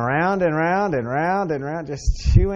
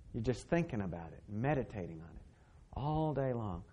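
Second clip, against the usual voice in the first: hushed; 4 semitones lower, 14 dB quieter than usual. Acted. Preacher drops to hush.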